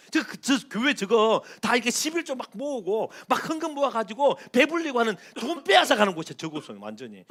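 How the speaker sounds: 가볍게 흉내 낸 교회 비난자; 불평하는 세상 사람을 살짝 흉내 내듯; 교회 비난하는 사람. Retold or imitated. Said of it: "세상 사람도 그런 사람 많아요". (교회를 욕하는 세상 사람 흉내, 약간 큰 소리로)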